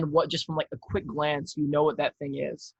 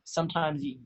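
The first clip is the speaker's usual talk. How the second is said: ordinary talk, nothing marked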